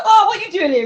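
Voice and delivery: high, goofy innocent question; silly surprised. Retold or imitated. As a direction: play dumb in a high voice. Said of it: "I'm like".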